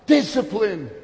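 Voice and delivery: loud, high, drawn-out sermon climax; full-force preaching. Real, not acted. Preacher driving the point home to the congregation, full-force preaching. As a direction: preach it out at a slow shout.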